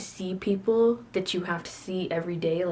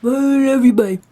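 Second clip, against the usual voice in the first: sign-off with gusto; 6 semitones higher, 13 dB louder than usual. Real, not acted. Signing off the video to viewers, with gusto. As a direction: belt out the goodbye with gusto. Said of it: "it needs to have gusto".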